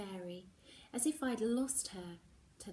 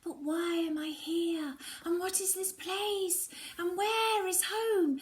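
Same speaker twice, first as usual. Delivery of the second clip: higher, louder, tumbling questions; bewildered questions; 10 semitones higher, 4 dB louder than usual. Acted. Lost girl begs the frost man for answers, anxious and higher. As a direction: plead for answers, frightened and lost.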